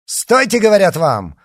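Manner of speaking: high, loud command; insistent shout. Acted. Monseigneur repeats his halt to the riders, shouting. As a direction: force them to stop at once.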